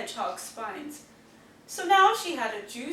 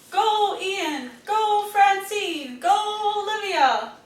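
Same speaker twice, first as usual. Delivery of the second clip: higher, louder sing-song cheer; 6 semitones higher, 6 dB louder, slower than usual. Acted. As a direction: cheer the racers on. Cheering on the toy boat race.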